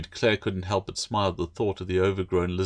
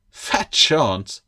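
louder, dismissive quip; scoffing girl; 5 semitones higher, 6 dB louder than usual. Acted. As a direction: scoff at him. Claire scoffs.

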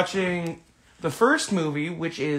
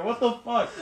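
normal talking voice

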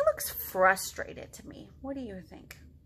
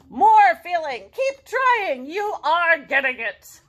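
coaching Gerald; 5 semitones higher, 11 dB louder, slower than usual. Acted.

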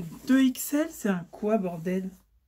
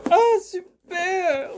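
higher, louder, slow drawn-out "super"; excited slow cheer; 10 semitones higher, 7 dB louder, much slower than usual. Real, not acted. Vlogger unboxing a find she likes, slow excited cheer. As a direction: savour the word with delight.

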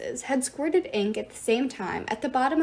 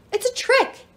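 high, loud warning yell; alarmed yell; 13 semitones higher, 5 dB louder than usual. A narrator's reading. Iris warning of a trick, alarmed yell. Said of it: "Iris yelled".

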